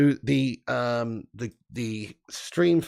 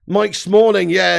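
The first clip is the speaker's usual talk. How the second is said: usual speaking voice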